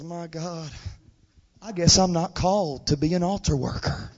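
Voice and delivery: low, very soft resignation; retold dejection. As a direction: let it sink, quiet and defeated. Retold discouraged altar worker.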